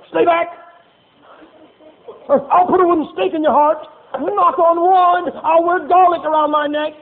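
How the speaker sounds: high, loud, hammed-up panic; mock panicked warding off. Preacher mocking a frantic vampire-hunter's cry, high and loud. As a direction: shout it like you're fending off a vampire.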